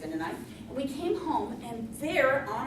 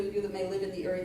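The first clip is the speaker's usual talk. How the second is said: ordinary talk, nothing marked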